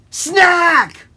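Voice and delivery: sudden high, loud shout; spring the trap with a shout; loud villain reveal; pouncing fox. Acted.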